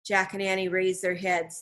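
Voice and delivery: plain reading voice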